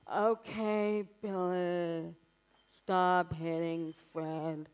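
slow, droning, bored daycare teacher; exhausted teacher. Retold or imitated. Tired daycare teacher scolding a child, slow bored drone. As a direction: drag it out like you can barely move. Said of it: "teachers were so exhausted and horrible".

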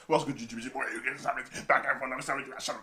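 usual speaking voice

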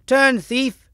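high, loud call to halt; shouted challenge. Acted. Percival challenges the Red Knight from behind, shouting. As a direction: shout out sharply at a fleeing thief. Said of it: "shouted Percival".

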